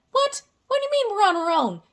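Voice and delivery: a high What, then a fast baffled question; mock shocked humans. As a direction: play the stunned humans. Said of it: "And we're like".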